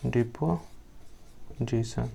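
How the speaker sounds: Deposition drawn out slowly and softly; slow dictation. Shown as a demonstration. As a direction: stretch deposition so they can note it. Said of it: "deposition भी बोलते हैं".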